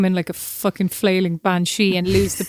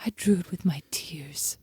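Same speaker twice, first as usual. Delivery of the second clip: slow, hushed, tragic whisper; dramatic whisper; 3 semitones lower, 7 dB quieter, slower than usual. Retold or imitated. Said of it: "he goes".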